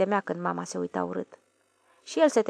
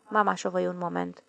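usual speaking voice

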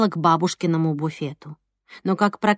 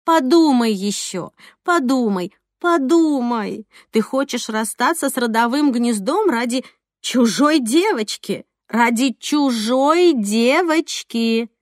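higher, slow cartoon cat voice, coaxing; cat Matroskin voice; 4 semitones higher, 3 dB louder than usual. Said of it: "голосом кота Матроскина втолковывала Алёна".

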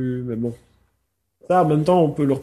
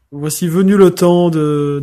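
singsong show intro; 2 semitones higher, 8 dB louder, slower than usual. Pretend. Jingle catchphrase intro.

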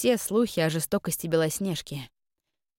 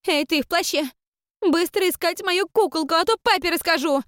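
put-on much higher, louder bratty child; spoiled child voice; 11 semitones higher, 5 dB louder than usual. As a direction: shout orders like a brat. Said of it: "капризно протянула".

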